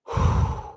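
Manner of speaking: long breathy exhale; real sigh, overcome mid-story; heavy sigh. Real, not acted. Overcome while recalling a memory.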